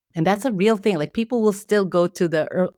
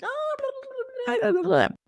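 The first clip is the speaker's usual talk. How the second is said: put-on baby talk; 3 dB quieter than usual. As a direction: coo at a baby at a family gathering. Cooing at relatives' babies.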